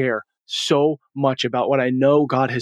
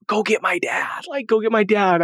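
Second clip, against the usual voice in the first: eager daughter; 7 semitones higher than usual. Retold or imitated. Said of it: "to tell their husband".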